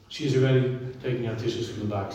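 ordinary talk

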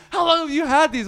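hammed-up furious shout; retold screaming. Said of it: "He starts screaming at me".

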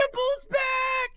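very high, slow, loud shout; ecstatic shout. Real, not acted. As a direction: howl it in joy. Hyped shout.

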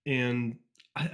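ordinary delivery

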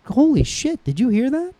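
high-pitched astonished reaction, re-enacted; retold amazement. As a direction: act out a roomful of stunned parents. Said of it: "Everyone in the room went".

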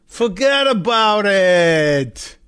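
slow, drawn-out Jersey "fuhgeddaboudit"; hammed-up Jersey wiseguy; New Jersey accent. Mock New Jersey exclamation.

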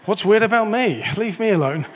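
hammed-up defensive outburst; mock indignant. Acting out an indignant comeback, joking.

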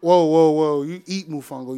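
ordinary delivery

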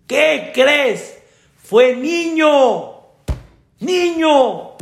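high, drawn-out proclamation; excited announcement. Acted. Doctor telling the father it's a boy, excited. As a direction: announce it with pitch soaring and vowels stretched.

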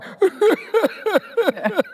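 high-pitched mock sobbing; demonstratively faked boo-hooing; fake crying. Shown as a demonstration. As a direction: burst into put-on, high staccato sobs. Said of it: "I'm gonna cry".

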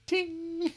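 high sung ding like a glint of light; a bright, high chime; ding sound. Acted. Sound effect in read story.

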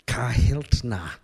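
slow, drawn-out Midwestern "Kahiltna"; Don Sheldon. Retold or imitated. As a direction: say Kahiltna in an exaggerated Midwestern accent. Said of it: "this exaggerated Midwestern accent".

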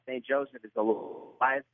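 usual speaking voice

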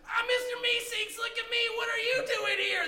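squeaky helper creature calling out; Mister Meeseeks. Acted. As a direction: demand answers with eager high energy. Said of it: "one of them says".

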